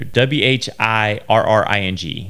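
slowly spelled letter by letter; spelling out. Shown as a demonstration. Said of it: "spelling the word out".

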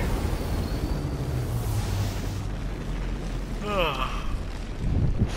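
long drawn-out yawn. Real, not acted. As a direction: let out a big tired yawn. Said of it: "Sorry about that yawn".

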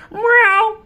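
high, loud mock meow. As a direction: call out like a cat. Cat meows.

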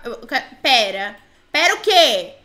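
normal talking voice

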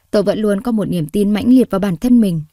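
ordinary delivery

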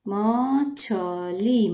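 मछली stretched very slowly; sounding out slowly. Shown as a demonstration. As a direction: draw out मछली syllable by syllable.

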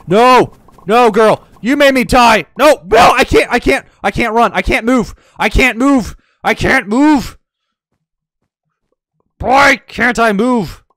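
high, loud cries of being stuck; frustrated yelling. Real, not acted. Player shouting at another player and the game, frantic yells. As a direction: yell in real frustration, unable to move.

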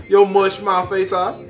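ordinary talk, nothing marked

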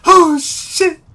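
high panicked yelp with laughter; panicked shriek. Real, not acted. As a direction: shriek in fright, half laughing. Hider about to be found.

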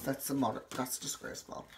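breathy whisper of disgust. Real, not acted. Judging his old outfit, whispered disgust. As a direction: hiss it under your breath, appalled.

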